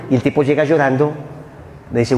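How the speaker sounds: normal talking voice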